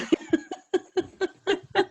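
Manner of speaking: high breathy laugh. Real, not acted. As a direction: burst out laughing. Laughs at imitation.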